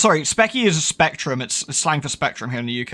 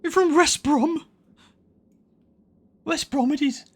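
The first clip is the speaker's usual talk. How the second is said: mock Brummie; 12 semitones higher than usual. Brummie voice continues.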